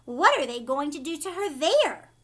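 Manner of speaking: loud, alarmed demand; frantic mother. A narrator's reading. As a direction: demand answers in a panic.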